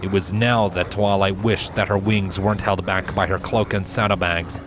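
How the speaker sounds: usual speaking voice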